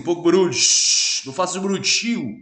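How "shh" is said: loud hissing shh; loud shush. Shown as a demonstration. Teacher demonstrates the Portuguese hush sound. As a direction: shush the room loudly. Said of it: "o português diz assim".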